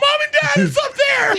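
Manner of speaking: high excited shout across an arena; calling out. Retold or imitated. Comic to his parents in the skybox, calling out loudly.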